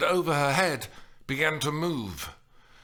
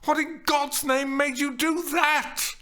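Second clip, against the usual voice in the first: high, loud outraged tirade; angry high-pitched shouting; furious shout; 5 dB louder than usual. A narrator's reading. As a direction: yell it in disbelieving fury. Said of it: "she shouted".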